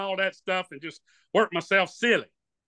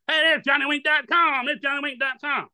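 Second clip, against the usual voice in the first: higher, excited shout of recognition; guys calling out; 6 semitones higher than usual. Retold or imitated. Guys on a jungle tour spotting him and calling out excitedly. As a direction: spot him and yell it out. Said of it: "They go".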